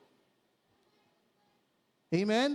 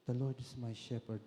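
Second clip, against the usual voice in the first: much lower and softer, almost under the breath; softly; 8 semitones lower, 9 dB quieter than usual. Shown as a demonstration. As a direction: say it gently, barely above a murmur. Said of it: "Pwede rin akong mahina".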